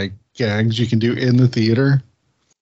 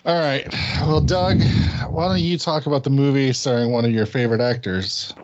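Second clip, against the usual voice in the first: ordinary delivery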